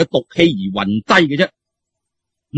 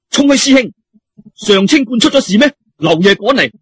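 raised questioning call; a hint of alarm, raised; calling out to a senior; 6 semitones higher than usual. Character voiced calling out.

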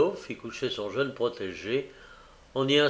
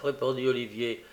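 usual speaking voice

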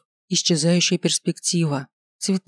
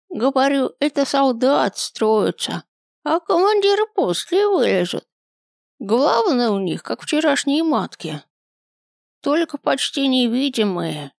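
put-on very old man; 9 semitones higher than usual. A narrator's reading. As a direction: groan it out like a frail old man.

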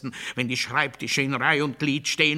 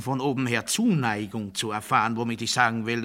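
ordinary talk, nothing marked

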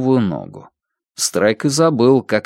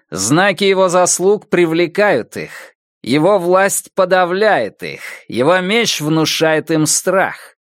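slow, higher declaiming voice; declaiming quote; 7 semitones higher, slower than usual. Acted. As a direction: read it out like a grand proclamation. Anomie tweets voiced.

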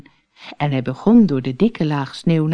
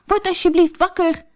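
calling out; 14 semitones higher than usual. Acted. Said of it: "riep Barney".

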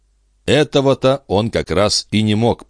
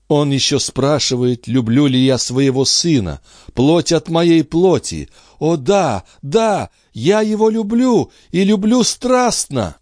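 passionate mother; 10 semitones higher than usual. Acted. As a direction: let indignation swell into ardent love, climbing higher. Андре страстно признаётся. (страстная мать, всё выше и горячее)

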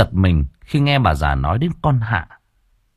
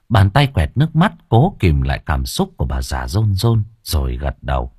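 ordinary delivery

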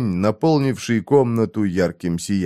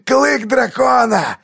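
high, slow laughing shout; 13 semitones higher, slower than usual. A narrator's reading. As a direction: shout through creaky laughter. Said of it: "затрясся в приступе скрипучего смеха".

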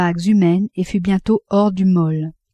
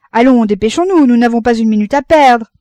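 raised, higher urging voice; urgent hurrying; 4 semitones higher, 7 dB louder than usual. A narrator's reading. Father rushing his wife off the ship, urgent. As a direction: hurry them along loudly.

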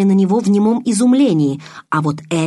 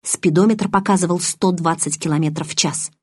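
usual speaking voice

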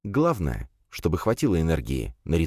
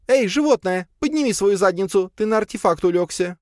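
high, bossy yell; calling out sharply. A narrator's reading. Lea orders the sleeping cat to move, yelling. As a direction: holler at a lazy pet in a high voice. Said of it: "крикнула Пал Палычу".

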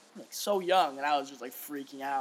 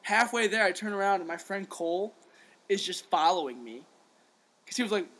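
ordinary talk, nothing marked

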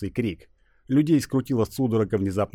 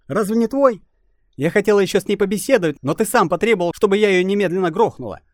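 put-on annoyed young woman; 11 semitones higher, 6 dB louder than usual. Naida's own voice.

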